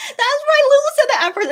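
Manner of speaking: high, loud burst of amusement; amused outburst. Real, not acted. Streamer reacting to a viewer's joke, amused outburst.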